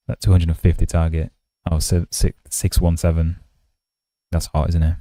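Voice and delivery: playful mock ASMR, mic held close; jokey close-mic streamer voice. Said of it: "Using your voice as A S M R content".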